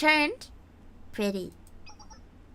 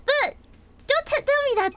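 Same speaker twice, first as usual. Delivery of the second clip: excited protest. Real, not acted. Begs not to be spoiled.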